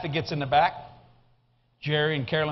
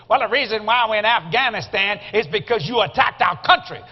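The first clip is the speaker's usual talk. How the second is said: loud, raised mock-angry retort; imitating mad wife; 3 semitones higher, 5 dB louder than usual. Wife arguing back at the cab driver, mock angry. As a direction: play an indignant wife telling someone off. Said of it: "then Kathy gets mad".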